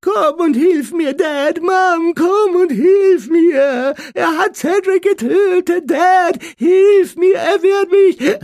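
high, loud drawn-out wailing; hammed-up tearful shrieks; mock crying. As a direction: scream for help like a sobbing crybaby. Dudley keeps mocking Harry's cries.

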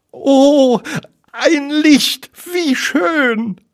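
very high, delighted piglet; squeaky, high-pitched delight. A narrator's reading. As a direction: gasp with small-creature joy at the sight. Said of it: "freute sich Ferkel".

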